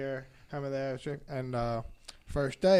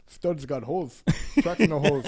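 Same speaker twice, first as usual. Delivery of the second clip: put-on Jamaican accent; 9 dB louder than usual. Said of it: "He's like".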